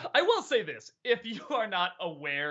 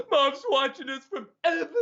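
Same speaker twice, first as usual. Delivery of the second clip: tongue-pierced brother voice; 9 semitones higher, 5 dB louder than usual. Retold or imitated. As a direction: imitate the brother talking round a pierced tongue. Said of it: "like that".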